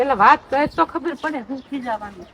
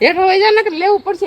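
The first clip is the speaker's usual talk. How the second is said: raised, louder real warning; angry scolding; 6 semitones higher, 5 dB louder than usual. Real, not acted. Mother warning son to go downstairs, angry scolding. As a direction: scold him as a threat.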